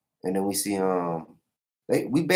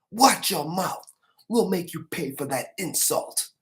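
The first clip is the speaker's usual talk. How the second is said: angry threat; 9 semitones higher than usual. Retold or imitated. Knights threaten the archer.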